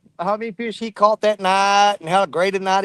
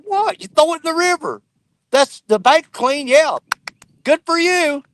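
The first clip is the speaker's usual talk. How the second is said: retold sarcastic outrage at the litterer; sarcastic irate retort; 6 semitones higher than usual. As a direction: scoff at him, pitch jumping up. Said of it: "I get a little irate about it".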